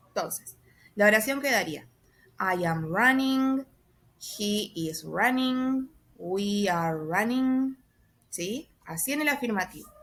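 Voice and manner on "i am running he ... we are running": drawn-out English example sentences; slow model sentences. Shown as a demonstration. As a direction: model each sentence slowly for learners. (frases de ejemplo dichas lentamente)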